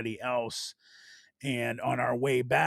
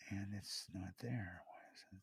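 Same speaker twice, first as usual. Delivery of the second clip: whispering; 5 semitones lower, 21 dB quieter than usual. Real, not acted. Muttering to himself while searching video.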